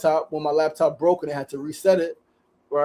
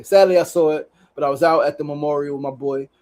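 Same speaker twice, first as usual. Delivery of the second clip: normal talking voice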